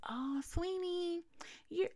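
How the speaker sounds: high, slow, saccharine voice; mock the insincere mourner sweetly; annoying fake-sweet lady. Mocking the fake-sweet lady at mom's funeral, high and saccharine. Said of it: "yes, she sounded like this".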